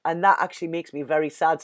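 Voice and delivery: ordinary talk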